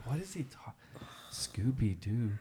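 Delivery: hushed, puzzled kids imitated; soft retold murmur of bewilderment; baffled whisper. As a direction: whisper it in confusion, as if among classmates. Baffled kids whispering about teacher.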